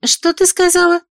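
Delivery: high, loud astonished question; surprised. Acted. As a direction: caught off guard, delighted. Friend surprised outburst.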